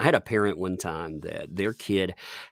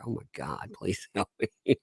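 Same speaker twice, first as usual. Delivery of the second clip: pleading aside; 8 dB quieter than usual. Retold or imitated. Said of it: "You're like".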